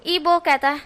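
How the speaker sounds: plain reading voice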